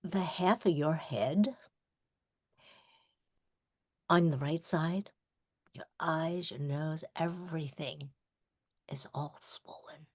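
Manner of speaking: low and soft, fading to a whisper; soft doctor voice. Retold or imitated. Doctor gently explaining her injury, fading softly.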